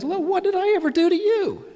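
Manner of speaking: high, odd donkey voice with a complaining tone; donkey asking its rider why; talking donkey. Acted. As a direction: squeak it out like a hurt donkey. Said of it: "and the donkey turns around and says".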